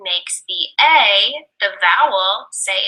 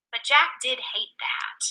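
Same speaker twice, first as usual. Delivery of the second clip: whisper; 8 dB quieter than usual. Acted. Reading a story.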